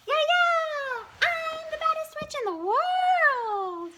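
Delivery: high, loud witch yell. A narrator's reading. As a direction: shriek it like a gleeful little witch. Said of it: "yelling through her mask".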